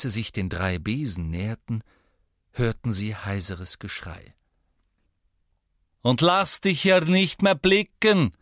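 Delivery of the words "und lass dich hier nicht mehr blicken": loud, slow barmaid bellow; shouting. A narrator's reading. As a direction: throw him out with a yell. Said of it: "rief Madame Rosmerta".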